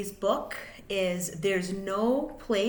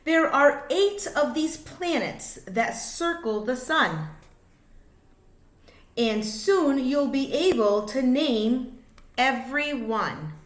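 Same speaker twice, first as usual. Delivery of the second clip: lively, sing-song picture book reading; reading to children; 4 semitones higher, 5 dB louder than usual. A narrator's reading. Reading a rhyming space book to kids, sing-song. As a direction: bounce the rhymes for little listeners.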